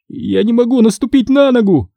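high-pitched mild panic; panicked cry. A narrator's reading. Injured boy telling friends his leg fails, panicked cry. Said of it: "в панике вскричал он".

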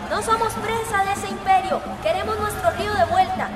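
rallying cries with punched endings; protest slogans. Acted. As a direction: call out demands to a crowd of protesters. Protest leader shouting slogans. (gritando consignas de protesta)

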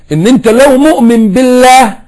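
high, slow and loud; preacher lifting his voice; rising emphatic call. Real, not acted. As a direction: build the point with force.

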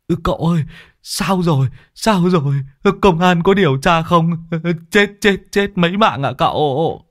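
high, whimpering anxious stammer; fearful high voice, tripping over repeated words; panicked questioning. Acted. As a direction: plead frantically for news. Culprit rushes over begging for news.

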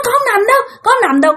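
high, crying refusal; frightened cry. Retold or imitated. Girl refusing the ghost woman, frightened crying. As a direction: cry out in fear.

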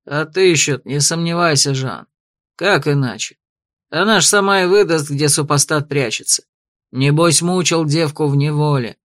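low, lightly voiced rough man; gruff tavern thug. A narrator's reading. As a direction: grumble it like a tavern brute. Thug character speaks.

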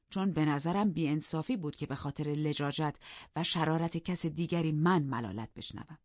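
ordinary delivery, nothing marked

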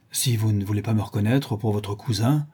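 ordinary delivery

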